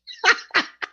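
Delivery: high-pitched burst of laughter. Real, not acted. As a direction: crack up at your own punchline. Laughs at own punchline.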